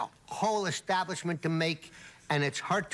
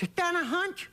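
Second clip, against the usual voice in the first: high, surprised mock slurred question; speech-impaired guy, surprised; 10 semitones higher than usual. Patron asks the hunchbacked bartender, high surprised mock voice. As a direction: act amazed in the guy's impaired voice. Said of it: "the way I speak".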